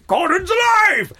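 very high, slow, bellowed roar; explosive drawn-out theatrical shout; Brian Blessed catchphrase. Acted. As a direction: roar it like a heroic ham actor. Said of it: "perform his famous catchphrase".

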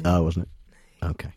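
soft low whisper. Real, not acted. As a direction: drop to a slow whisper. Hushed aside on air.

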